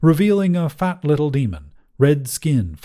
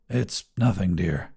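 shaken and quiet; 6 semitones lower, 6 dB quieter than usual. A narrator's reading. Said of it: "he managed to say".

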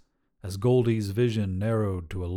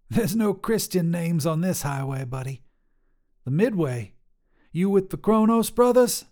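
higher, bold trucker woman voice; tough lady trucker; 7 semitones higher than usual. Acted. Trucker Sharon sizing up a hitchhiker, brash and higher. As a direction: call out like a brash long-haul trucker.

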